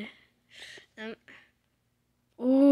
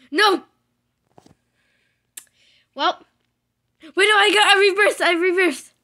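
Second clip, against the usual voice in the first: high, loud panicked shouting; panicked yell; 9 semitones higher, 18 dB louder than usual. Real, not acted. Player shouting as his ship reverses off the moon, panicked. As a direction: yelp in alarm as it goes wrong.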